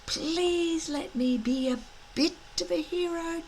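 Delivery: soft, high, hopeful private plea; inner thought. Acted. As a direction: think it quietly to yourself. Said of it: "each thought to themselves".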